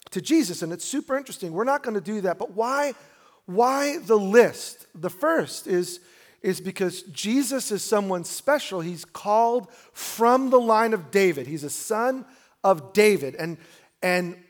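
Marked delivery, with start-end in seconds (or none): 2.4-13.3 preaching. Acted. Preaching with rising force.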